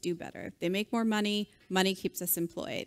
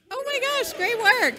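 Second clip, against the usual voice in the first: high, loud gushing praise; over-the-top cheering for a beginner; 9 dB louder than usual. Pretend. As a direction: gush over their first program.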